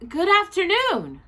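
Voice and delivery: an unsettled man greets a bear; doubtful. Acted. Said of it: "replied Mister Brown doubtfully".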